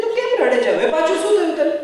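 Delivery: high, fast bewildered question; puzzled Kabir. Retold or imitated. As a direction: rush the question, baffled. Kabir asks why she cries.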